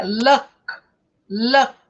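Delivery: luck stopped on the k, no vowel after. Shown as a demonstration. Teacher contrasting luck with lucky. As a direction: end luck hard on k. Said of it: "não devo colocar um som de vogal".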